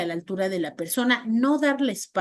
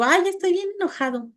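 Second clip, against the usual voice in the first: mismatched angry claim; 6 semitones higher than usual. Shown as a demonstration. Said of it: "porque si yo digo".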